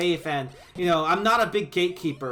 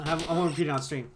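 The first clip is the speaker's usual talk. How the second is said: usual speaking voice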